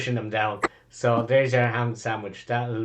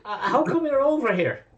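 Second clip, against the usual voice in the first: amazed fan; 8 semitones higher than usual. Acted. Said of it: "He says".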